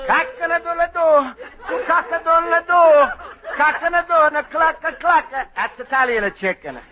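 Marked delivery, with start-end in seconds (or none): 0.1-5.3 slow, high crowing like a rooster. Pretend. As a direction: crow it out for the boy like a rooster. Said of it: "I'm gonna make you like a chicken".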